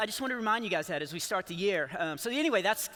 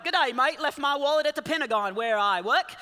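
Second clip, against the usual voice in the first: a hint of a put-on Australian accent, higher and louder; fake Australian accent; 5 semitones higher, 5 dB louder than usual. Nervous dad on a date faking an Australian accent, lightly hammed up. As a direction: ham up a dodgy Australian accent. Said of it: "I'll fake an accent".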